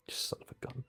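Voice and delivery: whispered mock heartbreak; very soft, voiceless lament; choked-up whisper. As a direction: curse under your breath, acting upset. Retold upset at owl's death.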